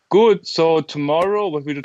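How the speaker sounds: bright burst of energy; excited. Real, not acted. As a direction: perk up suddenly. Upbeat switch to plans.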